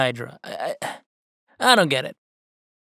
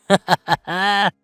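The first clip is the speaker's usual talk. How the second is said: amused little laugh; 2 semitones higher, 4 dB louder than usual. Real, not acted. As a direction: laugh it off lightly. Laughs at being sponsored.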